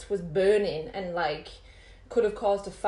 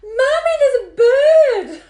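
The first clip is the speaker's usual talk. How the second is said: put-on high shrieking child's cry; screaming daughter finding a bird; 13 dB louder, slower than usual. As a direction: scream it like a startled little girl. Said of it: "next thing she just screams out".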